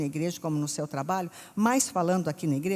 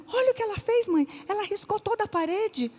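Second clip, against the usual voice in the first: very high, exasperated complaint; whiny complaining daughter; 13 semitones higher than usual. Retold or imitated. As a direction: tattle to mother in a high wail.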